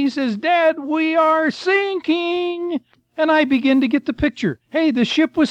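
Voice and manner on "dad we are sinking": slow, high mock holler from the son; retold holler. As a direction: call it out like a worried son on the river. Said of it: "he finally hollered at me".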